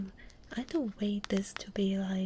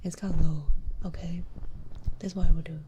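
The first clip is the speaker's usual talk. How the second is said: low, relaxed streaming voice; lower voice; 3 semitones lower, 7 dB louder than usual. Shown as a demonstration. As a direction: drop the pitch and relax. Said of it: "So it's, like, lower, right? Like this".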